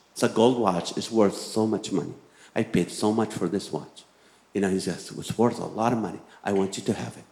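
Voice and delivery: soft, hushed confiding voice; a hushed, low pitch to sell the watch's worth; hushed relative. Retold or imitated. Rich relative quietly urging him to take the gold watch, hushed.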